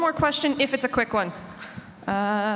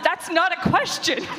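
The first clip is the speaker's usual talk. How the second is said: higher, laughing through the words; laughing tease; 7 semitones higher than usual. Real, not acted. Moderator teasing the Audible rep, laughing. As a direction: tease them while laughing.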